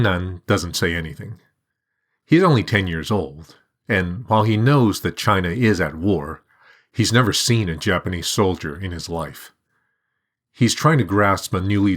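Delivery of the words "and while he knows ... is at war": usual speaking voice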